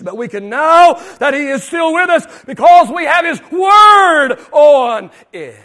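loud, ringing preacher's declaration; preaching at full force. Acted. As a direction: proclaim it to the room. Preaching climax.

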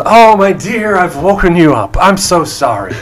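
high, loud apologetic gnome; effusive gnome. Acted. Gnome entertainer to woken lady, effusive high apology. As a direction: fuss loudly over a lady you woke. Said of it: "the gnome turns around and he says".

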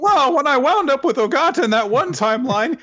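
high, exaggerated indignant complaint; hammed-up high-pitched grievance; mock character outburst.

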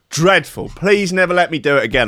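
ordinary talk

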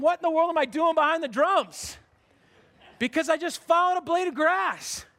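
high-pitched comic bafflement; bewildered sheep. Acted. Sheep finding itself behind the drums, bewildered. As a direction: act out a puzzled, sheepish realization. Voicing a lost, puzzled sheep.

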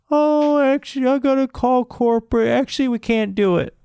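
high-pitched weaselly imitation; mock store clerk. Store rep backing out of the pickup, mocking high voice.

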